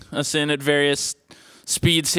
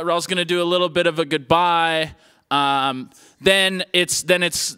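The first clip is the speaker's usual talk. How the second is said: usual speaking voice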